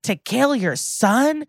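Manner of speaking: high, drawn-out mock outrage; retold scream. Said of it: "Rollins screams".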